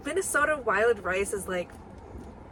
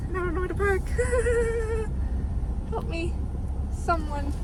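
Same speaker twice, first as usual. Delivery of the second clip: playful distress; 11 semitones higher than usual. Real, not acted. Lost, can't find parking.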